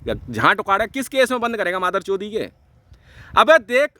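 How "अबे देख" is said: loud, high angry yell; loud, high heated yell; angry call. Real, not acted. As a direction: bark it out to grab him. Heated rant at opponent.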